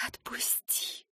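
slow, soft whispered plea. Acted. Eva begging Lentar to let her go, whispered. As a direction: barely breathe the word out.

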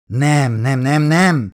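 doctor protesting to the captain, loud. A narrator's reading. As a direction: refuse it with eager insistence. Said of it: "ellenkezett Holloway doktor".

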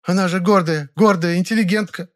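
high-pitched, indignant village woman; angry cry. A narrator's reading. Woman complains about her proud mistress, angry cry. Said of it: "сердито вскричала женщина".

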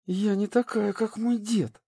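a hint of gritted teeth, hissing; hissing through teeth. A narrator's reading. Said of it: "сквозь зубы прошипела Шень".

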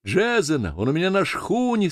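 high, moved announcement; trembling voice. A narrator's reading. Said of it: "дрожащим голосом". (взволнованно, высоким голосом)

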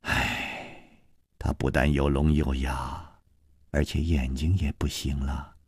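soft, low, breath-heavy regret; sorrowful near-whisper; long sigh. A narrator's reading. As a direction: sigh deeply, then speak hushed and low. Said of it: "南平长长的叹息了一声".

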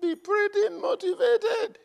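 high, slow stretched motivated; emphatic preaching. Real, not acted. Preacher urges the church to be pretty motivated, slow and high. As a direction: drag out motivated slowly and high. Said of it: "preacher stresses point".

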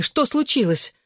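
high, worried exclamation; crying out. A narrator's reading. Mother nearing the bench asks her daughter, alarmed. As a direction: ask in alarm, pitch lifted. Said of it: "снова крикнула женщина".

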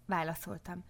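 ordinary delivery, nothing marked

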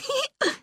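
high, thin little laugh; thin giggle. A narrator's reading. The girl giggles in bed at night, a thin, high little laugh. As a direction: giggle thinly. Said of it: "тоненько засмеялась я".

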